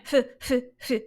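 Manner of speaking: short breathy huffs, one after another; repeated air puffs. Shown as a demonstration. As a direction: imitate stopping the air each time. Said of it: "You're still going".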